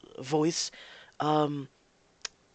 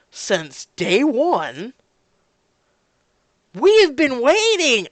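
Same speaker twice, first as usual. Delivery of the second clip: frustrated fan; 7 dB louder, slower than usual. Retold or imitated. Loyal fan demanding the game studio make the sequel, building to a shout. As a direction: plead for the sequel with rising outrage. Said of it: "which I'm sitting here going".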